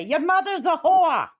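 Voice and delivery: loud high mock yell; hammed-up screamed insult; retold shout. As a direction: shout it like the friend did. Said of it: "was watching my friend say".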